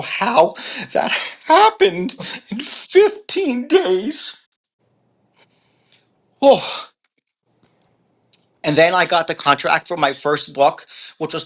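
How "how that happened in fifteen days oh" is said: slow, high, with a laugh in it; amazed disbelief. Real, not acted. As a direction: marvel at how it happened. Disbelief at debts resolved.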